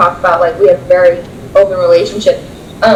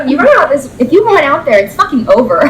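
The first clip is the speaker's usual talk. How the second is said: mock ultimatum; 6 semitones higher than usual. Girlfriend to boyfriend, forbidding escape, mock threat. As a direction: lay down the law, half joking. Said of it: "I'm like".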